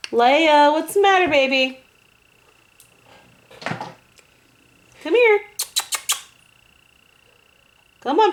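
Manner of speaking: high sing-song calling the cat; pet-talk calling. Real, not acted. Owner calling her howling cat, high coaxing pet voice.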